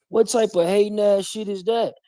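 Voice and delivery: high-pitched incredulous question. Real, not acted. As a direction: ask it high, in disbelief. Incredulous reaction to the quoted boast.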